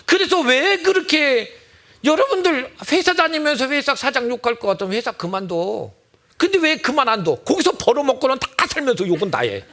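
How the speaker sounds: preacher rebuking complainers; scolding. Acted. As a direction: tell them off sharply. Scolding about cursing the boss.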